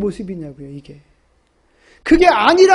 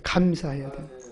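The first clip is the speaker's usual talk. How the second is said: much softer and lower; quiet echo; 10 semitones lower, 10 dB quieter than usual. Shown as a demonstration. Preacher repeating 감사해야 되는 거예요 to himself, softly. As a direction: repeat it under your breath.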